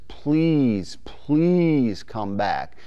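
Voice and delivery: slow drawn-out pleading please; begging plea. Shown as a demonstration. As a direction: plead long and slow like a lonely hen. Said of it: "they're just begging".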